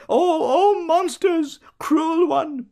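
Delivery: high, anguished crying out; goaded cry. A narrator's reading. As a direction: wail under torment. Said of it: "Bloom, goaded".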